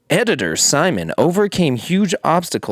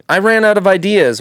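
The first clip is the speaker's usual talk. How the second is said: put-on higher, louder Japanese accent; 6 semitones higher, 5 dB louder than usual. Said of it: "but in a Japanese accent".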